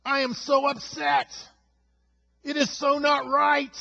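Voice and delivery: high, loud, furious ranting at God; angry complaint to God. Acted. Preacher acting out a believer raging at God, loud angry ranting. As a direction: shout your grievances at heaven, fed up. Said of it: "just let it come out in your talk to God".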